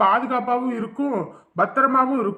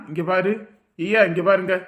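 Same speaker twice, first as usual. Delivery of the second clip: usual speaking voice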